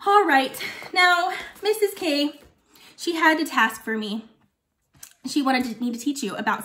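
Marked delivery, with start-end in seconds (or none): none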